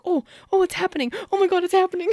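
high, loud fangirl squeal; surprised, excited. Retold or imitated. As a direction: relive the shock, voice jumping up high. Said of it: "I was still surprised".

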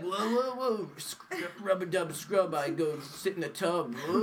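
mock squeaky rubber ducky; sing-song bath toy voice, lightly done; goofy rubber duck impression. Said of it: "You're talking like a rubber ducky".

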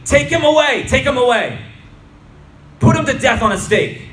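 high, loud mob demand; forceful high-pitched yell; crowd shouting. A narrator's reading. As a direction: shout it urgently over the crowd. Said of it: "they shouted".

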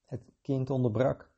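usual speaking voice

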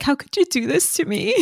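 high-pitched, hammed-up hurt protest; mock betrayal. Teasing co-host as the deceived wife, mock-betrayed wail. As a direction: play the wounded lover, overdone.

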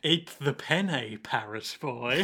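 slow, low order to a pet; slow hammed-up coercion; mock menacing command. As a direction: draw it out like a quiet threat. [name] ordering bird to eat.

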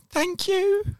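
high squeaky flustered thank you; awkward thanks. Retold or imitated. Mocking her own flustered reply to a compliment, high and squeaky. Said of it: "I was just like".

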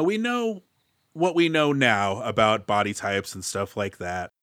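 ordinary delivery

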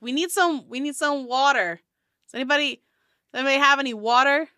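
dwarf asking for water. Acted. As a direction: call out for water, rushing. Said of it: "he's like".

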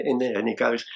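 normal talking voice

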